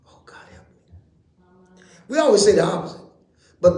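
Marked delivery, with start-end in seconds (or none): 0.1-1.8 whispered aside. Real, not acted. Preacher's aside under his breath.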